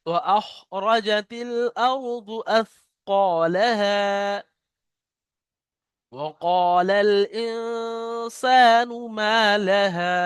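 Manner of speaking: slow, drawn-out tajweed chant; Quran recitation. Shown as a demonstration. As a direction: recite each verse slowly and melodically. (ধীর সুরে কুরআন তেলাওয়াত)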